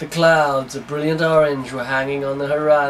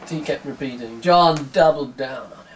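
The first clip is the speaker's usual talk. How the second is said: ordinary delivery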